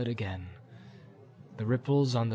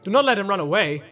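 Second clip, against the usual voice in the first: raised, louder warning; sharp command, higher and louder; urgent order; 8 semitones higher, 8 dB louder, faster than usual. Acted. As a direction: call out a firm instruction. Boromir orders Sam about the pony.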